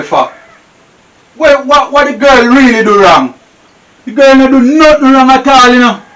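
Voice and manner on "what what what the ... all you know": put-on louder and higher, defending the girl; loud protest. Husband loudly defending the maid to his wife, shouting.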